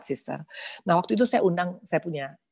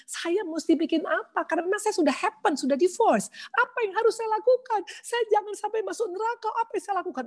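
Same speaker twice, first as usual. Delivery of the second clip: much higher, pleading and tearful; reliving her desperate question after the divorce sermon; desperate crying plea. Retold or imitated. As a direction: beg for an answer in panic. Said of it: "Jadi saya bilang".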